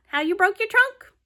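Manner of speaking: high, excited pig voice; Piggy voice. Acted. Piggy asks Gerald about his trunk, high piggy voice. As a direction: squeal it out as Piggy.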